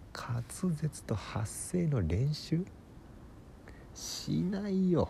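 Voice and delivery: drawn-out, high-pitched disbelief; incredulous. Acted. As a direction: stretch the words out in amazed protest. 呆れたように他人の行動に突っ込む. (信じられないという調子で、高く長く伸ばして)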